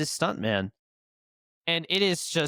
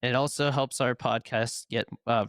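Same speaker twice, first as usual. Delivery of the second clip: ordinary talk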